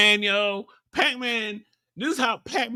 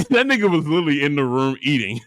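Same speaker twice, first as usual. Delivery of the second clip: ordinary delivery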